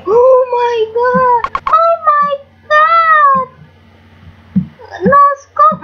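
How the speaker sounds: stunned, slow high cry; excited. Real, not acted. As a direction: shriek in disbelief. Stunned at a game shot.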